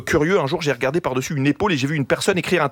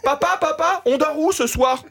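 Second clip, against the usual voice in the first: put-on much higher whiny kid calling papa; child's voice; 10 semitones higher than usual. Child on the plane asking his father where they will sleep, in a high voice. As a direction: pipe up like a small child asking his dad.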